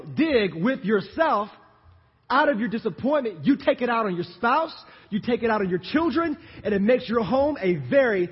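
loud, high, punchy sermon delivery; full-force preaching. Real, not acted. Preacher urging church to work harder, full-force preaching.

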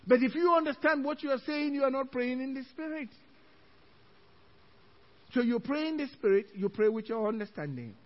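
ordinary delivery

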